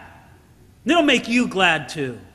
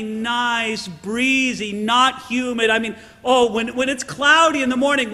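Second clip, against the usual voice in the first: usual speaking voice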